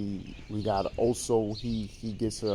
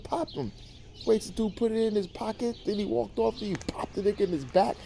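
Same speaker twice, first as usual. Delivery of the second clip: high, incredulous outburst; can't believe what he's watching; shocked disbelief; 10 semitones higher than usual. Real, not acted. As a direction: viewer reacting to Franklin's killing, high disbelief. Shocked reaction to the show.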